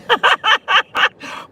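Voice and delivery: short laugh, pitch jumps up; high laugh. Real, not acted. Laughs at own gum joke.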